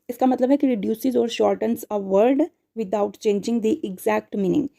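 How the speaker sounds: very slow, deliberate dictation; teacher dictating a definition slowly. Shown as a demonstration. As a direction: read the definition out slowly for notes. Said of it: "teacher reads definition slowly".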